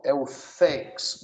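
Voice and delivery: "thanks" drawn out very slowly, tongue between the teeth; slow TH demo. Shown as a demonstration. Teacher shows the TH in "thanks" very slowly. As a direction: stretch the TH at the start of "thanks". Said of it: "então, ó".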